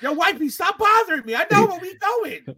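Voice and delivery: high, hammed-up exasperation; irritated husband. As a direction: brush off the wife in a high, indignant voice. Driver snapping at his wife.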